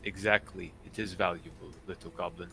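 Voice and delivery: low, softly spoken captain; retired pirate captain. Acted. Captain confirming the scroll's worth to goblin, low and soft.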